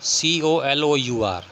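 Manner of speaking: letters spaced out slowly; teacher spelling a word slowly for students. Shown as a demonstration. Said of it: "British English में दिया गया है कलर".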